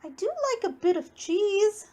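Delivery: lightly voiced mouse asking sweetly; little mouse voice. A narrator's reading. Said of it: "said the mouse".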